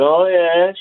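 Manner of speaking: high-pitched Southern Shore twang; affectionate take-off of a Southern Shore accent. Retold or imitated. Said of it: "in her Southern Shore twang".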